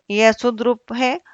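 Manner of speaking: ordinary delivery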